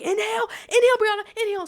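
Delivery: loud, high-pitched order to yourself; frantic self-command. Retold or imitated. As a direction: drill yourself, overdoing the urgency. Acts out ordering herself to inhale.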